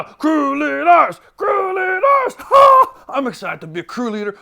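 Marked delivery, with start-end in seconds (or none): 0.0-2.3 high, loud, slow chanted cheer. Acted. Camp character cheering on the crew leaders, rising cheer. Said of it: "I got a little cheer". 2.4-3.0 high, loud triumphant "ha!"; cheer shout. Acted. Camp character finishing a cheer for crew leaders, high shout.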